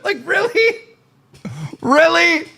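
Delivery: high, incredulous "really?"; mocking disbelief. Real, not acted.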